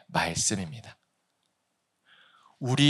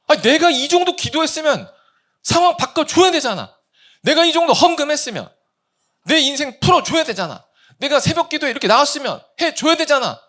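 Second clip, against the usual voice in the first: very high, loud indignant rant; angry demand; 6 dB louder than usual. Acted. Preacher voicing believer demanding payback from God, angry rant. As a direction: demand your due for all you gave. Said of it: "이 분노 안에 섞여 있는".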